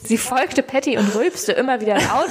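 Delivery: ordinary delivery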